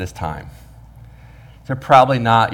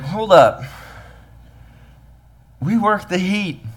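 mock indignation; 9 semitones higher than usual. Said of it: "He's like".

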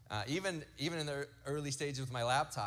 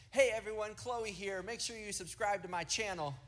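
high, bright YouTuber intro voice, louder; little girl vlogger; 8 semitones higher, 3 dB louder than usual. Retold or imitated. Dad imitating his little girl Chloe as a YouTuber, high and chirpy. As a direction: play the little daughter greeting her fans. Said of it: "she looks at it and goes".

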